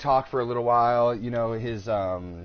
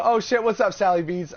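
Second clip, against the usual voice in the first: excited greeting; 8 semitones higher, 5 dB louder than usual. Real, not acted. Greeting someone arriving.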